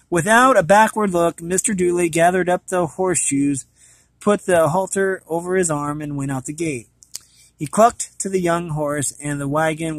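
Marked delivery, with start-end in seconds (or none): none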